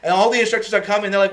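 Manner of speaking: usual speaking voice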